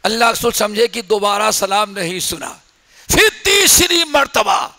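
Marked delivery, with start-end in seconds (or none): none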